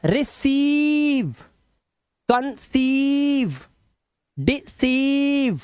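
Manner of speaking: रिसीव, कंसीव, डिसीव with the ee hugely stretched; long vowel sound. Shown as a demonstration. Teacher shows EI as long vowel, stretching रिसीव, कंसीव, डिसीव. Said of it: "ये भी long vowel sound है".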